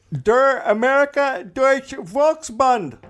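slow, high, German-accented name; hammed-up German reading; German pronunciation. Shown as a demonstration.

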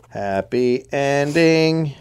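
dry, slow sarcastic aside. Real, not acted. Host deadpanning a grim story's end, slow sarcasm. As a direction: drawl it out slowly, deadpan irony.

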